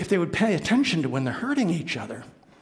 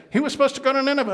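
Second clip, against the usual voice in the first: fast, high announcement; 11 semitones higher, 6 dB louder, much faster than usual. Acted. Captain telling the crew.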